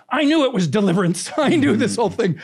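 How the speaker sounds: laughing. Real, not acted. Retelling dad's panicked thought.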